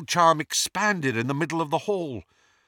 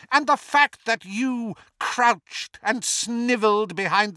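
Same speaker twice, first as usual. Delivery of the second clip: raging villain; 10 semitones higher, 3 dB louder than usual. A narrator's reading. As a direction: spit scorn at your rival, pitch climbing. Voldemort rages back at Harry.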